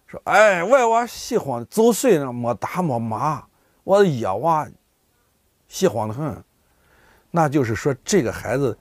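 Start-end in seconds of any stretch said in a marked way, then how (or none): 0.2-6.4 drawn-out, pitched-up sigh of sympathy, easing down; pitying dialect lament. Acted. Villager pitying an orphaned child, drawn-out sympathetic lament. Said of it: "他带有同情心".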